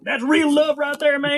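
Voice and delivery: mock admiration for a patriot's devotion; mock flag fanatic. Keeps mocking the flag-loving supporters.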